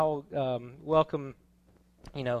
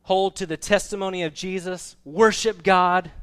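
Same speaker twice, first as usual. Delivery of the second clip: raised voice, louder at the close; angel's command; 5 semitones higher, 8 dB louder than usual. Acted. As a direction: proclaim it as the angel's charge. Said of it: "when the angel of the Lord says".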